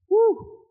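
very high, short "woo"; amazed whoop. Acted. [name] seeing the total on his calculator, high astonished whoop.

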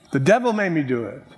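ordinary talk, nothing marked

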